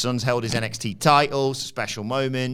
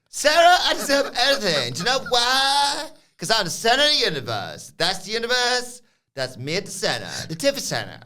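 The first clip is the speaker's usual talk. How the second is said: Tiffany Stratton; 11 semitones higher, 3 dB louder than usual. Retold or imitated. Host mimics wrestler addressing interviewer, exaggerated squeal. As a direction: squeal it like a hammed-up princess. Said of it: "Tiff says".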